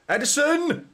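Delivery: very high, loud yelled name; startled yell of disbelief; shocked shout. Real, not acted. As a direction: cry out the keeper's name in alarm. Goalkeeper's risky back heel.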